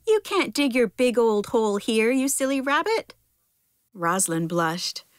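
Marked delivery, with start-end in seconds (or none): 0.1-3.1 scolding worm, higher-pitched mild shout; mild story shout. A narrator's reading. Worm tells off a silly rabbit for digging, mild high shout. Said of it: "shouted the worm".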